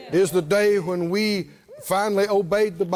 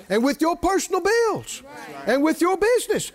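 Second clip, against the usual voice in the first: ordinary delivery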